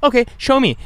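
high, mocking challenge; a high mocking shopkeeper voice; mocking manager. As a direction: pitch it up like a snippy clerk. Manager's challenge in retold scene.

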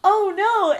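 high, loud retold alarm; mock dismay. Woman reacting to a stuck rider, mock dismay. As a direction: imitate her startled reaction.

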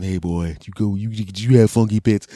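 loud, hearty father voice; gruff dad. Acted. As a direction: boom it like a proud dad. Dad talks to son.

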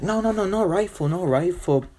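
high, panicky denial; Joanna. Acted. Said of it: "Joanna's like".

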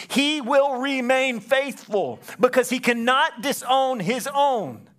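high, loud, rising preacher's fervour; a climbing pulpit crescendo, loud and high; preaching at full force. Acted. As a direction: lift the whole room, preaching hard.

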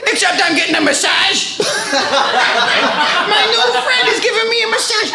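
suddenly high-pitched exclamation; delighted outburst. Acted. Guest reveals his new friend's massage, high and thrilled.

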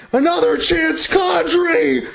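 shouted goal call, voice cracking. Real, not acted. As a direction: voice cracking on a high excited call. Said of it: "I'm sorry about the voice cracks".

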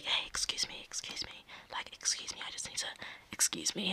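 whispered apologies, squeezing through a crowd; sneaky whisper. Retold or imitated. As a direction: hush it, sorry to bother everyone. Will sneaking past the crowd.

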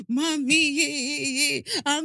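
mock toddler cry, high stretched-out vowels; imitated child wailing. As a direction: wail like a sorry toddler, hammed-up. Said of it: "when she now stretches the cry".

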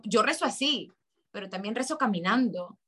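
normal talking voice

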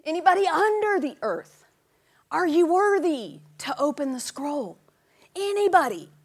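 high, loud searching call. Acted. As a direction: shout it out to the whole room. Calling out again.

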